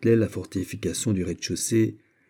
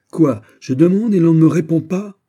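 loud, high incredulous question; indignant rebuke; 8 semitones higher, 10 dB louder than usual. Acted. General scolds soldiers who do not answer him, indignant. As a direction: demand an answer sharply.

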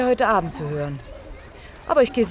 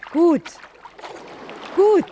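praise; 6 semitones higher than usual. Acted. Coach praising swimmer.

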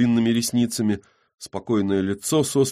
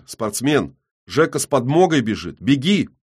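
urgent shout; 5 semitones higher, 6 dB louder than usual. Acted. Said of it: "вдруг заявляет".

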